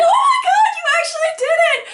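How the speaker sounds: very high, loud excited squeal; re-enacted shriek of joy; freaking out. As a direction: squeal it fast in thrilled disbelief. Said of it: "freaking out".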